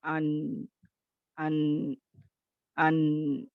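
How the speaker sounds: "अल" and "अन" with a sound that carries on briefly; medium letter demo. Shown as a demonstration. Teacher demonstrating correct medium articulation. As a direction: keep the voice running, moderately. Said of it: "थोड़ा-सा दरमियानी".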